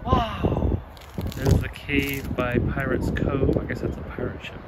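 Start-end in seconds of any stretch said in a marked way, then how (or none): none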